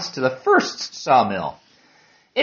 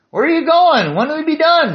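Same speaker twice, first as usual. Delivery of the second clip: put-on very high, louder boy questioning; young boy's voice; 13 semitones higher, 5 dB louder than usual. Blonde boy quizzes a passing hiker, high child voice. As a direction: pitch it way up like an inquisitive kid.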